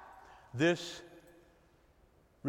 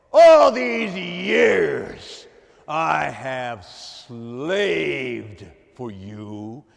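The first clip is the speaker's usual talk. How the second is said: loud, very slow, dragged-out grievance; bitter complaint; 9 dB louder, much slower than usual. Acted. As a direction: drag every word out in resentment. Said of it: "Here it goes".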